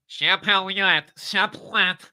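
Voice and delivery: nasally American mispronouncing Chapelette; nasal American accent. Shown as a demonstration. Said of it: "I just sound like a nasally American".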